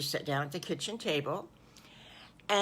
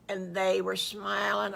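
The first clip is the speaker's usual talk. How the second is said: plain reading voice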